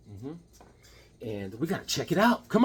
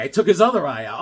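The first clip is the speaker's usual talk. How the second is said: normal talking voice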